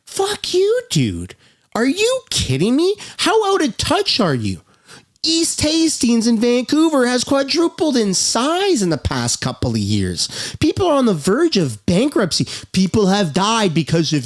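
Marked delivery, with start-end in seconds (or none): 0.1-10.6 real high-pitched, incredulous anger; triggered outrage. Real, not acted. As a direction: lash out in disbelief, pitch shooting up. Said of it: "I'm sorry for being triggered and".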